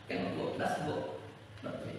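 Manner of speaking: normal talking voice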